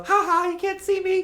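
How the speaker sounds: high, gleeful taunt; sing-song childish gloat; teasing hide-and-seek taunt. Pretend. Hiding in crate, teasing.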